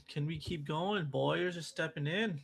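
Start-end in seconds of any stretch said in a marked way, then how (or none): none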